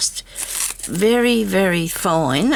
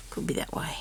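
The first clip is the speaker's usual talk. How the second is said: whispered under breath; whispering; 11 dB quieter than usual. Real, not acted. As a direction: murmur it to yourself. Muttering to herself.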